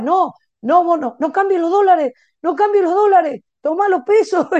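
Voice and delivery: loud, high insistence, imitating the pastor; insistent protest. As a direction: push back loudly, refusing the dollars. Pastor insists on giving pesos. (protesta insistente, alta y fuerte)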